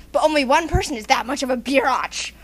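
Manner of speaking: normal talking voice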